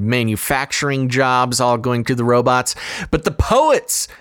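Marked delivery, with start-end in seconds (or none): 3.1-4.1 big rising alarm on poets; dramatic emphasis. Real, not acted. Dramatic warning about AI poets.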